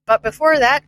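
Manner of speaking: usual speaking voice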